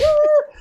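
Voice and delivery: loud, very high squeal; imitated excited squeal. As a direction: let out a delighted cartoon squeal. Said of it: "does this excited squeal".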